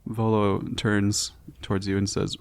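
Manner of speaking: ordinary talk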